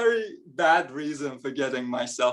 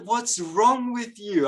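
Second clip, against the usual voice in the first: imitated appalled woman; 7 semitones higher, slower than usual. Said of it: "she said".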